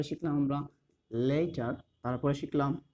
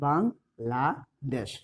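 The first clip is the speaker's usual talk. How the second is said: Bangladesh split slowly into three beats, বাং, লা, দেশ; Bangladesh broken up as বাং-লা-দেশ, each syllable drawn out; 3 semitones lower, much slower than usual. Shown as a demonstration. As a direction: say Bangladesh with a clear gap after each syllable. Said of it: "যখন তিনটা syllable".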